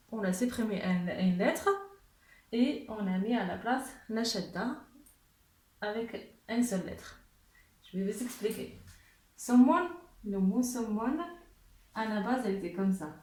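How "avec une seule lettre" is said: normal talking voice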